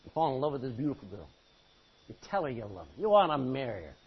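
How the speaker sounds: ordinary talk, nothing marked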